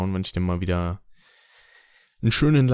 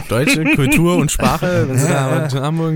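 mock sales pitch; 5 semitones higher, 4 dB louder than usual. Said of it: "hab ich dann direkt so gesagt".

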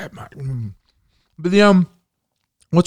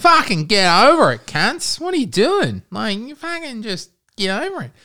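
mock t-shirt voice; 10 semitones higher than usual. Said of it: "I read it in your, your accent".

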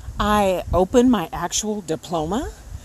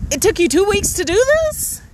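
higher and louder in disbelief; exasperated outburst; 13 semitones higher, 5 dB louder than usual. Real, not acted. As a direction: let the frustration burst out as a question. Venting at the slow office.

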